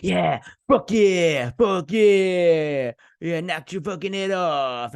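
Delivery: drawn-out, high, hammed-up cheer; mock triumphant yell. Hero cheering his own punch, mock triumphant yell. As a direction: crow it like an overacted action hero. Mocking show's over-the-top swearing.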